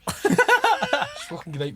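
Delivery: high squeaky laugh through the words; giggling out the answer, slow. Retold or imitated. Telling Klopp about his summer, laughing hard.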